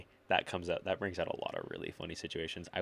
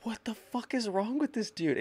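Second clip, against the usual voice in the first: much higher, disbelieving squeal; baffled classmates; 4 dB louder than usual. Retold or imitated. Classmates react to the teacher, shocked disbelief. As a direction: sound stunned and appalled. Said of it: "everyone's like".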